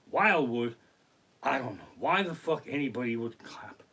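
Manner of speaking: usual speaking voice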